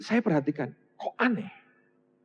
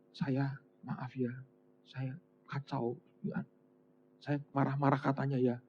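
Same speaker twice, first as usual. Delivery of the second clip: meek apology; 6 semitones lower, 16 dB quieter than usual. Retold or imitated. Sick man apologizing after recovery.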